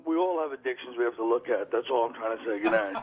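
low mock-solemn closing message; earnest sign-off. Comic suggests how the enema act should have ended, mock-earnest sign-off. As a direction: wrap up the act like a moralizing comic. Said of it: "should have ended it by going".

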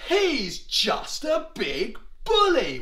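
high, slow, emphatic declaration; rally the troops, word by word; David. Acted. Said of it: "David said to the Israelites".

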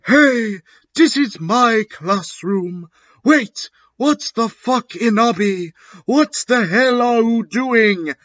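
high-pitched, hammed-up indignant woman; indignant teacher. A narrator's reading. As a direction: demand answers in a high put-on voice. Sinister finds intruder in her classroom.

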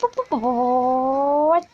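slow stretched-out hops; drawn-out hops. Real, not acted. Gamer dragging out hops as the car flies, slow. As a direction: let each hop hang, slowly. Calling hops while driving.

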